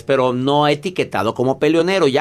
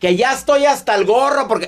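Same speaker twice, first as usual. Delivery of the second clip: high-pitched mock outburst; hammed-up angry interruption; imitated shouting; 9 semitones higher than usual. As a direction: act out a fed-up person raising their voice. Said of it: "a ver, estás gritando".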